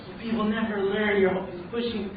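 mildly heated retelling of his own outburst; retold snap of anger. Man retelling how he snapped at people, irritated.

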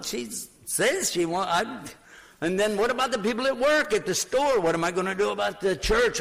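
acted-out agitated what-ifs; retold anxious rant. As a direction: pour out worries in a mounting panic. Acts out his anxious late-night rant.

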